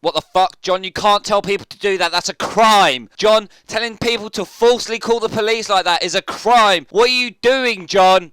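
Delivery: loud, high outraged shouting at the preacher; outraged yelling. Real, not acted. YouTuber yelling at the preacher over his advice, outraged shout. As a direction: yell it in disbelief at him.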